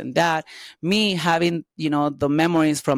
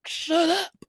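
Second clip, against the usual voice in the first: mock high snap; 13 semitones higher than usual. Slapping boyfriend in bar.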